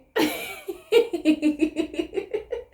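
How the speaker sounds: high giddy giggle. Real, not acted. Vlogger can't stop giggling about her man, giddy. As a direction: let the giggle run on. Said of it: "let me stop laughing".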